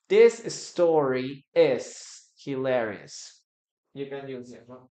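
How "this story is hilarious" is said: very slow, loud, every word clear; slow model sentence. Shown as a demonstration. Teacher reading the example slowly for the class. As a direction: spell it out slowly for the learners. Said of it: "teacher models the sentence".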